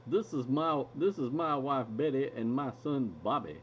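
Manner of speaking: put-on mild Texas accent in Hank Hill's manner; lightly drawn Texas twang. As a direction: say it like Hank Hill introducing his family. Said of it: "let me do the voice right".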